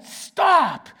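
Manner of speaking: sharp high shouted stop; a father yelling at his speeding son to stop; retold shout. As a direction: bark it out as a warning. Dad warning son driving fast.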